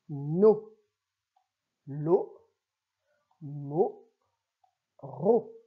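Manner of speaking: each syllable read alone, clearly spaced; lecture de syllabes. Shown as a demonstration. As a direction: model each syllable slowly for a beginner reader. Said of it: "je fais une première lecture". (syllabes lues lentement, une à une)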